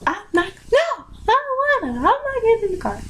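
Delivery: high-pitched retold teen refusal; defiant refusal. Sixteen-year-old refusing to get in mom's car, acted out defiant.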